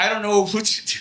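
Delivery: ordinary talk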